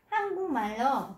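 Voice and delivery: Hăng cung mà lô, slow, hăng cúc spoken as hăng cung; nasal assimilation. Shown as a demonstration. Teacher slowly models the nasal sound change to students. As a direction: draw out hăng cung mà lô with a clear ng. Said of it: "đọc giống như N".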